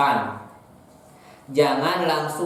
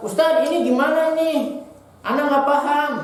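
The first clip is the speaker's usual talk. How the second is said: much higher, abrupt student blurting out; rude blurted question; 10 semitones higher than usual. Shown as a demonstration. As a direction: blurt it out without asking leave. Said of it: "Tidak boleh". (murid tiba-tiba bertanya tanpa sopan santun)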